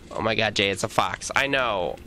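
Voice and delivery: normal talking voice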